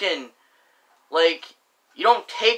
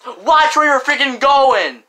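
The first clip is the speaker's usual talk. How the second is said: higher, louder, mock angry snap; rude snap; 8 semitones higher, 6 dB louder than usual. Stranger in the hallway yells after a bump, mock angry. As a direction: play the rude stranger yelling back. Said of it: "that person's like".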